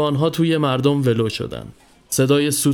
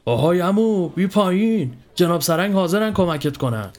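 high-pitched policeman's call, moderate volume; acted holler, pitched up; shouting upward; 5 semitones higher than usual. A narrator's reading. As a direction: call up to someone out of reach. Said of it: "پاسبان برگشت و رو به بالا داد زد".

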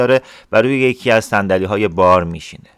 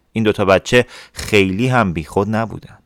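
ordinary delivery, nothing marked

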